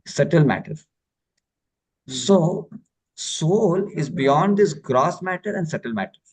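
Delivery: ordinary talk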